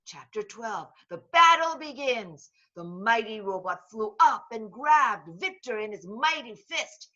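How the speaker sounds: plain reading voice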